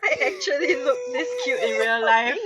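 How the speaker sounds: loud laughing mock brag. Real, not acted. Streamer joking about cute fan art, laughing. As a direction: brag through real laughter.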